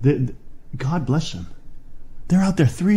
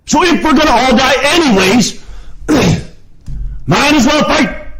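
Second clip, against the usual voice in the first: raised, high voice of resolve; rally them as a rebel ready to die; acting out a founder's fighting cry; defiant rallying cry; 9 semitones higher than usual.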